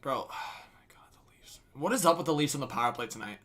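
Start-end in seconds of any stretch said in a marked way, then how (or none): 0.0-1.6 breathy sigh, then whispered disbelief; exasperated whisper. Real, not acted. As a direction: whisper it in despair at your team. Frustrated by Leafs power play.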